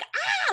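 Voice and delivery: a high, sharp scream of terror; high falling-scream "Ah!"; retold scream. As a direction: shriek it as if falling. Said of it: "Like, I'm screaming".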